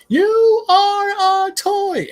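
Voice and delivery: high, slow, drawn-out yell. Retold or imitated. Woody yelling at Buzz under the van, high drawn-out yell. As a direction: yell it and stretch every word. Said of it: "he's yelling".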